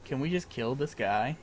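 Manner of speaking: ordinary talk, nothing marked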